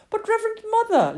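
put-on high, loud childish appeal; distressed protest. Lily protests to her mother through tears, high dismayed appeal.

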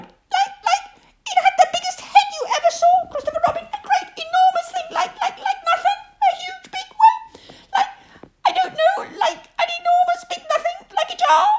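very high, squeaky gabble; frightened little pig, loud and flustered; panicked Piglet. A narrator's reading. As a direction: stammer and search for words in a squeal. Panicked Piglet describes the heffalump.

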